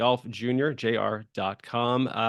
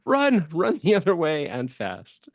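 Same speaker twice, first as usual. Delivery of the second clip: urgent warning; 6 semitones higher, 4 dB louder, slower than usual. Retold or imitated. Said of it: "It's like".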